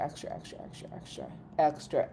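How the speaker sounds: breathy whispered repeats; whispering. Pretend. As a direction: drop to a whisper. Piling up extra strawberry.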